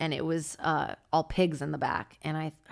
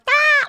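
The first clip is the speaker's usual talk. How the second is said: high piercing shriek, much louder; pained scream; 12 dB louder than usual. Retold or imitated. Imitating a person whose hair is being ripped out, a high loud shriek. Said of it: "when someone's ripping your hair out and you're like".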